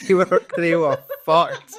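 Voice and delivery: laughing high and loud. Real, not acted. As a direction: laugh through the words. Laughing about gassed players.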